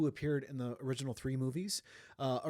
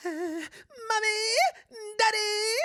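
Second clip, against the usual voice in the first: much higher, very slow, loud wailing cry; Nicolas Cage crying; 8 dB louder, much slower than usual. Retold or imitated. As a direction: wail for mommy and daddy like a crying child. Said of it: "and he's like".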